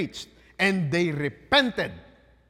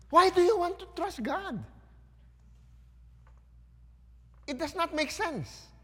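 much higher, mocking prophet voice; mock the sulking prophet, pitched way up; mocking Jonah; 11 semitones higher than usual. Preacher mimics grumbling Jonah.

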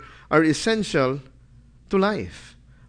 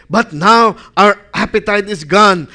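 "But now our appetite is gone" said louder, in full protest; loud complaint; 2 semitones higher, 8 dB louder than usual. Acted. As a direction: proclaim the lost appetite.

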